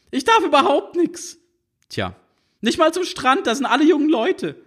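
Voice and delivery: put-on high, whiny teenage complaint; high teen girl sulking about rules; sulky teen. A narrator's reading.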